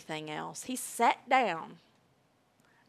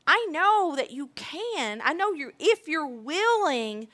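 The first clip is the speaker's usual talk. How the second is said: acted begging; 6 semitones higher than usual. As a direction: plead earnestly, stretching the key words. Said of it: "that's why people are begging God".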